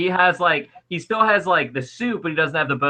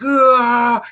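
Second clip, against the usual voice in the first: a higher pained grunt; a monster hit by the sword; demon death grunt; 9 semitones higher, 5 dB louder than usual. Acted. GM voicing the demon sliced through, pained grunt. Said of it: "he's like".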